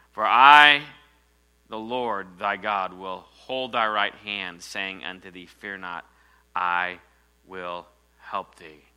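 slow, weighty reading of the verse; scripture reading. Acted. Preacher reading God's promise to the congregation, solemn and slow. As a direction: lay the promise down slowly and solemnly.

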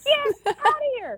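very high, slow get outta here; stunned disbelief. Retold or imitated. Hearing the speaker echo her prayer, stunned. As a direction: squeal it in shock.

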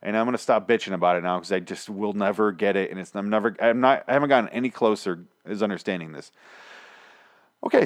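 ordinary talk

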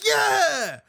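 high cheerful yell; excited whoop. Retold or imitated. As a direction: whoop like a freed man. Escaped prisoners cheering while running.

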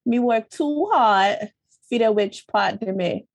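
slow imitated Jamaican accent; Jamaican patois. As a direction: play up the Jamaican patois as you read. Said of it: "It's spelt in accent".

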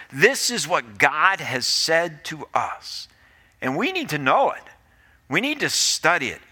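normal talking voice

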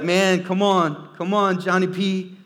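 excited cheering. Retold or imitated. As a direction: cheer him on like a happy fan. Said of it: "I was like".

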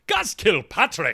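put-on loud, drawn-out Canadian accent sign-off. Host signing off a listener's letter, Canadian accent. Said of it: "that's my Canadian accent".

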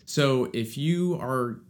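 ordinary talk

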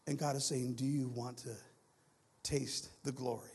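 low, soft, hushed preaching; solemn preaching. Real, not acted. Preacher voices God offering a taste of glory, solemn. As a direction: drop right down for God's question.

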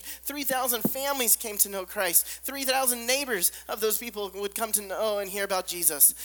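ordinary talk, nothing marked

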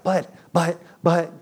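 put-on loud pleading stammer of a kid; hammed-up child begging; whining kid. Child pleading with dad, whiny mock stammer. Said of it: "and then we're like".

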